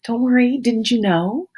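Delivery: high, loud, exaggerated soothing to crying kids; soothing mom voice. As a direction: coo over-the-top reassurance like a sing-song mom. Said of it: "I'd say".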